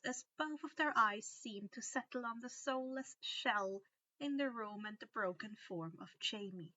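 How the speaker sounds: ordinary delivery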